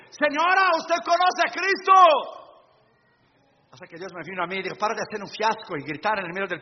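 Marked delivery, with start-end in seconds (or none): 0.1-1.9 higher, louder mock call down the hallway; hammed-up yell from behind the hotel room door; retold hallway shout. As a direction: shout it from the hallway to the cleaning lady. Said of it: "gritaba desde el pasillo".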